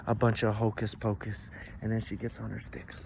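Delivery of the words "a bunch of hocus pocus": loud, slow, emphatic put-down; scornful. A narrator's reading. Witch dismisses his love, loud drawn-out scorn. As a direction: pour contempt on every word.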